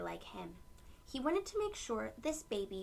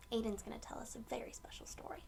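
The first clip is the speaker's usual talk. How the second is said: whisper; 6 dB quieter, much faster than usual. Real, not acted. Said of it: "whispered aside to listeners".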